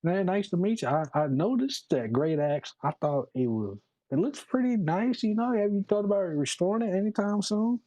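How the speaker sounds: put-on Minotaur accent. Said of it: "doing this accent in the Minotaur language".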